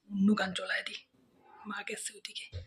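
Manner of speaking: whispered scheming. Acted. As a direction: whisper it while mother's away. Sneaky aside while mother away.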